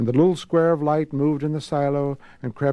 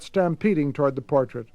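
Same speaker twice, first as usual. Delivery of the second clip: usual speaking voice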